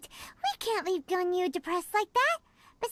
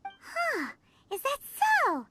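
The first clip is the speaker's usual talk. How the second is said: surprised; 8 semitones higher than usual. Acted. Surprised at the news.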